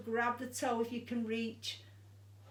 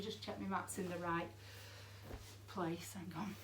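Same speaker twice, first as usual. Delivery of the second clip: whispered aside; 5 semitones lower, 9 dB quieter than usual. Real, not acted. Aside while adjusting her mat.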